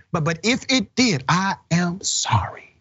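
high mock apology, slowing at the end; imitating the teacher. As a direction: mimic a stammered, insincere apology. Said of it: "And then she says".

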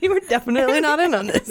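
high, fast mocking retort. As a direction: tease it in a high voice. Mock retort from the authorities.

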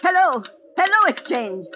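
loud, high calls for the exchange; frantic calling. Acted. Old woman shouting for the exchange, frantic. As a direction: shout down the line desperately.

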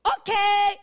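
loud hammed-up "okay!"; mock eager agreement. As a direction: blurt it out as a mock cheerful yes. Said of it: "이럴 거야?".

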